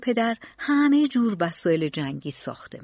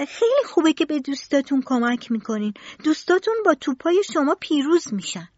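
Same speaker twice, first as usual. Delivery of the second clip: put-on higher, eager child's voice; innocent boy; 6 semitones higher than usual. A narrator's reading. Tistou praising helping friends, high and innocent. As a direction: sound naive and hopeful.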